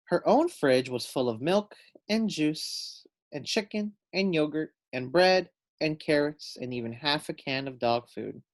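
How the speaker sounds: ordinary delivery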